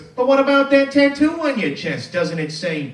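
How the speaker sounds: spring the gotcha on the witness; mock lawyer. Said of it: "And he goes".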